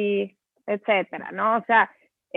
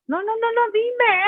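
much higher, coaxing little voice; pleading mother; 11 semitones higher than usual. Retold or imitated. Mother coaxing a shy child to talk, high and sweet. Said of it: "ves que dicen".

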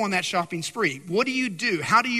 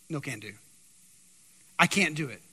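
low, hushed refusal; quiet refusal; 7 semitones lower, 5 dB quieter than usual. Retold or imitated. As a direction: drop it right down, soft and low. Quoting Naboth's curt refusal.

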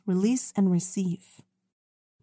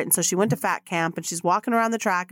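ordinary delivery

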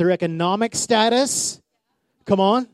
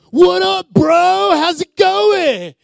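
loud, high, dragged-out bro greeting; hammed-up too-casual yell at a pastor; mock casual shout; 12 semitones higher, 9 dB louder, much slower than usual. Preacher mocking a disrespectful greeting, loud and high. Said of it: "I didn't go".

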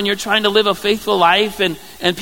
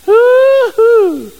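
mocking falsetto hoo-hoo, much higher and louder; sarcastic hoo-hoo; 10 dB louder than usual. Wife mocking David's dancing, loud sarcastic hoot. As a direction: hoot at him in scorn. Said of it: "you can see how sarcastic she's gonna be".

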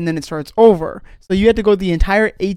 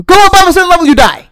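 sudden loud emphasis; 10 semitones higher, 8 dB louder, faster than usual. Acted. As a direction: burst out louder on the warning. Explaining mic death, suddenly loud.

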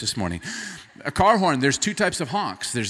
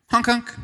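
high, bright little toot toot; light courtesy honk; 10 semitones higher, 6 dB louder than usual. Shown as a demonstration. Driver gently nudging a distracted driver, light playful honk. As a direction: tap it like a friendly car horn. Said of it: "Here's the courtesy honk".

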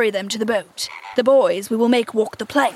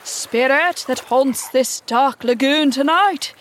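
slow, loud call, pitched up; raised voice with quiver; 4 semitones higher, 5 dB louder, slower than usual. A narrator's reading. Hook calls to the lagoon's spirit, raised quivering voice. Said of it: "Hook raised his voice".

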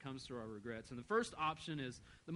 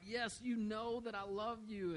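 a touch of exasperation, higher and slowed; mildly irritated; 8 semitones higher, slower than usual. Retold or imitated. Peter asked a third time by Jesus, mildly irritated. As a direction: answer the same question a third time, a little fed up. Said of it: "Peter is a little irritated at this point".

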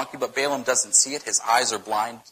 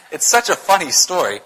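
laughing through the words, louder; tickled by your own story; real laughter in the voice; amused laughing; 5 semitones higher, 4 dB louder than usual. Real, not acted. Laughing at his own retelling.

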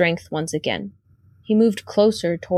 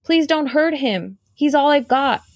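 higher, begging for her brother; pleading; 6 semitones higher than usual. A narrator's reading. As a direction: plead desperately with the creature. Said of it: "Sophie began to plead".

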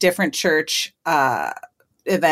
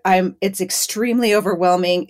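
ordinary delivery

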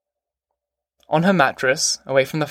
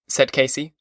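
plain reading voice

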